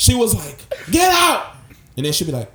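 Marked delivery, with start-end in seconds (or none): 0.9-1.5 high, loud imitated shriek; sudden shouted order; screaming. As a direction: yell it like a furious drunk woman. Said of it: "She screamed".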